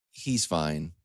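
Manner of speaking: ordinary delivery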